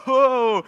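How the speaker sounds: a loud, high laugh breaking out; real burst of laughter. Real, not acted. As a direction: laugh out loud at your own line. Laughing at his own joke.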